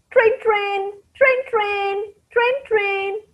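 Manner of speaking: high, soft ringing sound; imitated phone ring.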